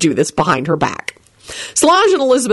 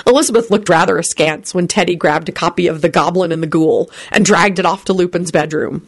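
usual speaking voice

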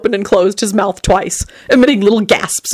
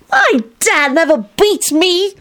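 put-on indignant boy; 12 semitones higher, slower than usual. A narrator's reading. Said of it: "Tony replied indignantly".

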